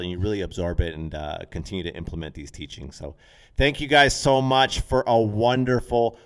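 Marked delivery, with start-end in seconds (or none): 3.6-6.2 excited thanks. Real, not acted. Enthusiastic podcast sign-off.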